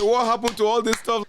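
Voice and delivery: to the host, retelling teasing his friend, laughing loudly; laughing tease. Retold or imitated.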